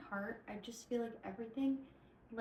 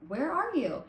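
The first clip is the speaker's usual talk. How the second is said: mock call, higher and louder; calling the dog; 6 semitones higher, 5 dB louder than usual. Boy in the yard calling for his dog, mock calling out. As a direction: call out into the dark for the missing dog. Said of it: "he's like".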